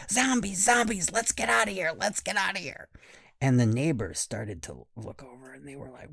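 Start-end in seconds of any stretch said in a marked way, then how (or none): 0.1-2.9 high, shouted mock alarm; mock screaming. Kids screaming as they flee the house, hammed-up alarm. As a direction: yell it like fleeing monsters. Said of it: "Everybody else was screaming".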